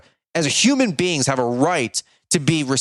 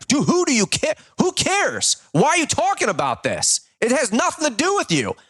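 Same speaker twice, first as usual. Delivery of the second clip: much higher, incredulous rebuke; can't believe you even care, say it up high; exasperated outburst; 9 semitones higher than usual. Real, not acted.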